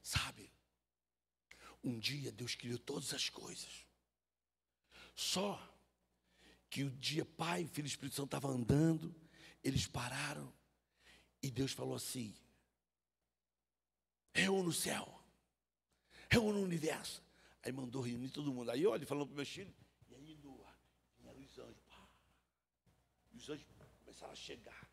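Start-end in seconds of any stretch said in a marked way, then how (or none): 0.0-17.2 put-on hushed tale told to a little boy; bedtime story voice. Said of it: "Eu falei assim". 20.1-24.9 whisper. Acted. Whispered story to son.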